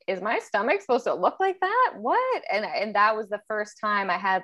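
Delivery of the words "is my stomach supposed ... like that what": higher, incredulous question; puzzled girl rising to a squeak at the end; childhood surprise. Retold or imitated. Young girl staring at a poster, rising incredulous question. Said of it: "And I was like".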